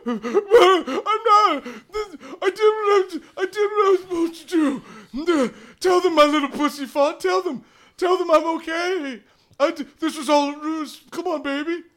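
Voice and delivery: high, wailing comic sobbing; hammed-up tearful pleading; mock crying. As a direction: blubber excuses in a squeaky cry. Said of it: "and Thaddeus starts crying".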